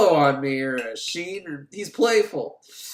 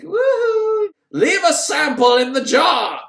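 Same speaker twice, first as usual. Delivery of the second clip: loud, much higher whooping yell; excited shout; 11 semitones higher, 7 dB louder, slower than usual. Retold or imitated. Doctor shouting at the medium, loud excited whoop. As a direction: whoop it out like a crazed scientist. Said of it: "He starts shouting at her".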